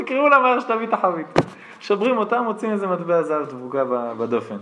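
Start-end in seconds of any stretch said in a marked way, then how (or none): 0.0-1.3 flustered order. Retold or imitated. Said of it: "התחרפן, הוא אומר".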